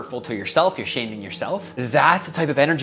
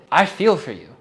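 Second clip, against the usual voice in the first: louder and higher with real feeling, eyes watering; choked-up sincere plea; emotional, heartfelt; 6 semitones higher, 6 dB louder than usual. Real, not acted. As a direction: push through the tears, heartfelt. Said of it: "I'm getting emotional just thinking about it".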